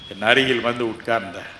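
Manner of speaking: usual speaking voice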